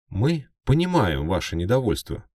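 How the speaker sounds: high, loud reporter voice. Acted. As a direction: speak like a nervous reporter. Off-screen reporter voice.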